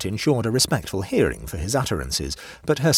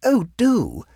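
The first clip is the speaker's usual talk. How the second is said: high, loud, earnest plea; earnest urging; 14 semitones higher, 6 dB louder than usual. A narrator's reading. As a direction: urge him earnestly in a high voice. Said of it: "said Mavis Pellington earnestly".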